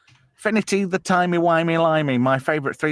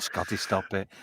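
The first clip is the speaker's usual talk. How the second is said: ordinary delivery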